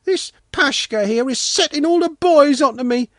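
put-on little boy's high, indignant wailing complaint; offended wail. A narrator's reading. Sanka denying he's a bourgeois, wailing in hurt protest. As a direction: pour out grievances in a high, loud child's voice. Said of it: "Sanka wailed, deeply offended".

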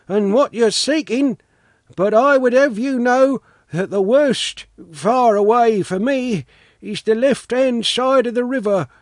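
put-on high-pitched storybook old man giving directions; old watchman. A narrator's reading. Watchman advising the travellers on the best far away, high-pitched and friendly.

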